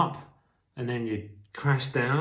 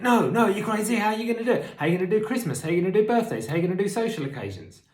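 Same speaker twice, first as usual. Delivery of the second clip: higher, louder alarmed inner voice; addict voice; 9 semitones higher, 4 dB louder, faster than usual. Retold or imitated. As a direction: voice of the craving, raised and high. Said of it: "It's the same voice".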